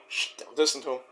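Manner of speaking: soft hushed plea; hushed aside. Retold or imitated. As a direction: shush him and warn under your breath. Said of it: "Joey's like".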